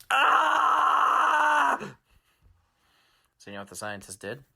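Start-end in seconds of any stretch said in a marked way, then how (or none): 0.1-1.8 long, loud, high distant wail; villain's long scream. Acted. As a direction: hold an anguished villain's scream. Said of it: "this was".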